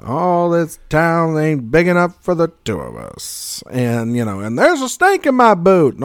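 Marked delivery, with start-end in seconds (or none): 0.1-3.6 slow, higher mock cowboy; Woody. Playing back the doll's catchphrases, hammed-up cowboy voice. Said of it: "pull the string and it talked". 4.4-5.9 high, loud mock cowboy doll; Woody. Listing the talking toy's lines, high cowboy voice. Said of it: "pull the string and it talked".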